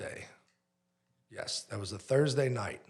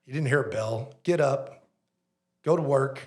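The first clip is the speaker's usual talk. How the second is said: louder, gruff coach-like inner voice; Rocky Balboa pep talk; 3 semitones higher, 8 dB louder than usual. Retold or imitated. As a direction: push yourself off the floor like a fighter. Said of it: "it kinda does that Rocky Balboa".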